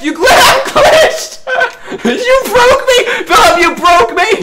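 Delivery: high, laughing shouts of disbelief; cracking up while calling out the glitch. Real, not acted. Glitched in game, laughing hard.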